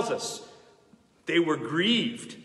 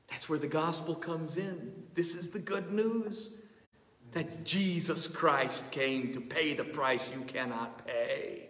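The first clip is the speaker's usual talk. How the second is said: much softer, lower, intimate preaching; tender gospel appeal; 5 semitones lower, 5 dB quieter than usual. Acted. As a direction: draw them in gently with the good news. Gentle gospel turn of sermon.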